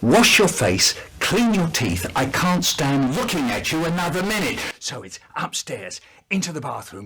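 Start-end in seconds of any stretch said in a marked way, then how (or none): 0.0-4.6 louder, firm scolding orders; stern mother scolding; cross mum. Retold or imitated. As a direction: tell him off properly. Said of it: "And she says".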